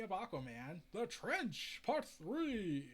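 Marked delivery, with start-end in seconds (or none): none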